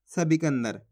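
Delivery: normal talking voice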